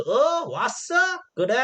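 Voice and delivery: high, offhand "oh, you came?"; king mocking Mencius as he arrives; sarcastic greeting. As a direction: sneer lightly as he turns around. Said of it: "비아냥거리는 거죠".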